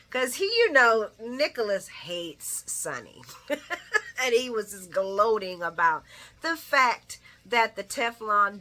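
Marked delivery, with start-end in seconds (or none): none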